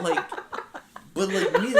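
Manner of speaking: high, loud laughing burst. Real, not acted. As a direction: break up laughing at yourself. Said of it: "I'm not capturing his essence".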